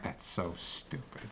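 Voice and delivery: breathy whispered scoff; a whispered sneer at the screen; mock whisper. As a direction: scoff under your breath, mock-disgusted. Retelling own reaction to scene.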